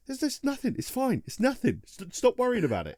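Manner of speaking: high-pitched, breezy denial; insistent brush-off. Retold or imitated. The guard brushes off his worried friends, high breezy denial.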